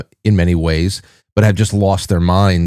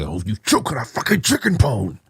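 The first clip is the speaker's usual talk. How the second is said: imitated muttered curse; 2 semitones higher, 6 dB quieter than usual. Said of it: "that's what it was like".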